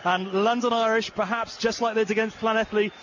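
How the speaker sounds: excited live commentary. Real, not acted. Commentator calling a London Irish try for viewers, building excitement. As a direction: call the attack rising to a shouting peak, then settle.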